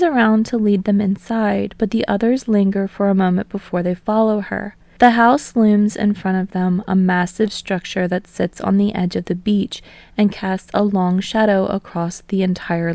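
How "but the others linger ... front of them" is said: plain reading voice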